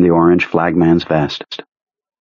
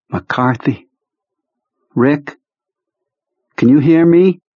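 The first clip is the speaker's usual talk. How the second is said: calling out; 7 semitones higher, slower than usual. Acted. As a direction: raise the voice to wake him. Trying to rouse a man.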